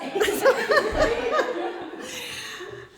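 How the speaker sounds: a high, bursting laugh of relief; relieved laugh. Real, not acted. Author finishing her dark story before the audience, bursting laugh. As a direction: laugh off the tension of a finished reading.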